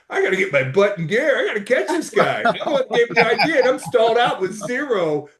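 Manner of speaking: laughing at being outdone by a friend. Real, not acted.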